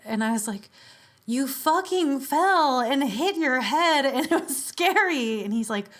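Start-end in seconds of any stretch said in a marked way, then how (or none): 1.3-5.4 higher, slower, half-laughing protest; upset outburst. Retold or imitated. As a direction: blurt out how scary it was, half laughing. Upset self quoted to friend.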